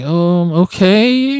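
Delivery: high, slow reluctant okay; hesitant okay. Retold or imitated. Driver reluctantly letting police search the car. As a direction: agree very reluctantly.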